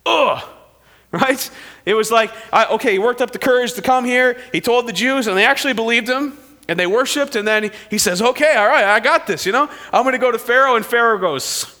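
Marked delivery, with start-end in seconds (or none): 0.1-0.4 frustrated groan. Real, not acted. Preacher groaning at Pharaoh's refusal to the congregation. 8.2-10.8 higher, louder self-encouragement; mild pep talk. Acted. Moses lightly psyching himself up before facing Pharaoh. Moses voiced gaining courage.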